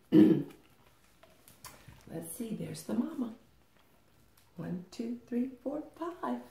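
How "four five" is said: usual speaking voice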